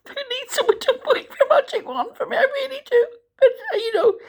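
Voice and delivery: a rueful laugh that tips into crying, pitched high; half laughing, half sobbing at her own wish; real teary laugh breaking into a cry; laughing through tears. Real, not acted. Wish for magic wand, tears.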